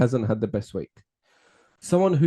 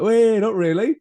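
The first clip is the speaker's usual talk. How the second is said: mock dead man returning; 10 semitones higher, 7 dB louder than usual. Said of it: "they rock up like".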